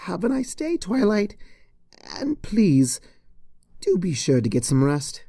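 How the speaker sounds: worried teacher checking on her; Cheerilee's voice. Acted.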